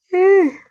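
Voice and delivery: loud high yawn; sudden big yawn breaking into the talk. Real, not acted. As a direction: let out a noisy yawn. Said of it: "sorry for the yawning".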